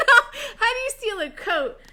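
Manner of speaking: laughing retry. Shown as a demonstration. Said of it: "I told it wrong".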